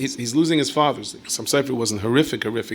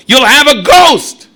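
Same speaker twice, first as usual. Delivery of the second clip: spooky warning; 13 semitones higher, 16 dB louder, much slower than usual. Acted. Dramatic warning in sermon.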